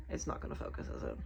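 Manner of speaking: very soft, nearly whispered aside; muttered aside. Real, not acted. Vlogger to herself as the camera won't focus, muttered. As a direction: drop to a voiceless murmur, as if to yourself.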